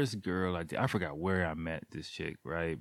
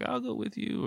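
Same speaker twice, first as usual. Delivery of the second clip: put-on shy girl voice. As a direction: lightly voice the shy girl agreeing.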